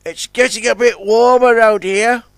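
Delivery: ordinary delivery, nothing marked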